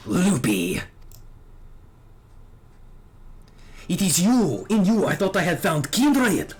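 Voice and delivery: hint of a French accent, proud and accusing; a young knight's cold contempt in a French accent. Dragoon confronting his old master, bitter French accent. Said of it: "time to rev up the French accent again".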